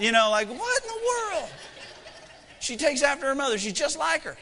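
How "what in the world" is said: high-pitched comic disbelief; incredulous dad, voice pitched way up; mock bafflement. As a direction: exclaim it in mock amazement. Dad's mock bafflement at daughter.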